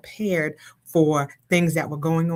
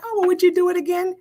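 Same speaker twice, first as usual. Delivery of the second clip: high, quick mock question; fast, high-voiced imitation of a questioner; imitating curious people; 4 dB louder, faster than usual. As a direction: mimic people asking, pitched way up. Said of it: "People are like".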